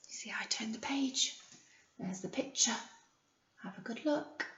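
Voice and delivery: soft whispered aside. Real, not acted. Teacher whispers to the class about turning the page.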